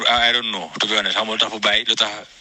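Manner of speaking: ordinary talk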